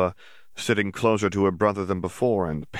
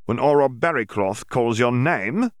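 a commanding official's voice, a bit higher; stern Auror; 5 semitones higher, 5 dB louder than usual. Acted. Yaxley addressing the gathered students, commanding. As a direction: lay down the law to the room.

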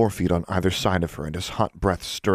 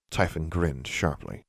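plain reading voice

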